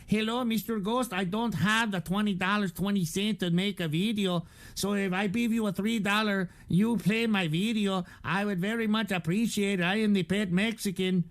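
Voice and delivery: a lightly put-on Mexican accent reading a donor's message; mock Mexican accent. Streamer mocking a donor's message in a put-on Mexican accent.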